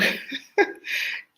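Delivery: high, airy laugh; real burst of laughter. Real, not acted. Speaker laughing at a viewer's comment, high and airy. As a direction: crack up at the comment.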